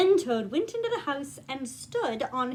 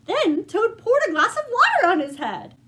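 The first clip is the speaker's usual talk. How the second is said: livelier, higher read-aloud as the story builds; rising storybook narration; 3 semitones higher, 5 dB louder than usual. A narrator's reading. Reading the climax to young listeners, rising excitement. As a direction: let the narration climb with the fun.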